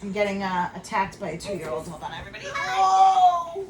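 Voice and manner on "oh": sudden high yelp; startled cry. Real, not acted. Mother yelping as her toddler climbs on her.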